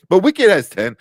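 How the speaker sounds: loud, high burst on Wicked; loud amazed exclamation; excited disbelief. Real, not acted. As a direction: blurt it out, amazed. Stunned by Oscar nomination count.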